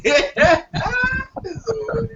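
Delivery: slowed down, laughing through the words; real laugh breaking up the line; wheezing with real laughter. Real, not acted. Laughing at the buffet joke.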